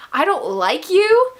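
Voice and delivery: imitated disbelief, rising high on "you"; drawn-out, high retold disbelief; mock incredulous. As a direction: act out a loud, slow, incredulous question. Acted quote of hurt disbelief.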